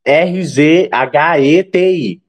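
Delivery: slow letter by letter spelling. Shown as a demonstration. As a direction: spell each letter out slowly. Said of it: "eu vou soletrar".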